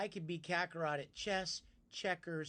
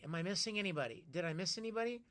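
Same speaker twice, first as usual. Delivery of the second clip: ordinary talk, nothing marked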